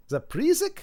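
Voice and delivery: Zapresic as "zap-REE-sick", pitched high and rising; questioning pronunciation. Shown as a demonstration. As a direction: ask Zapresic high, with a long EE. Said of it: "out of those seventeen pronunciations".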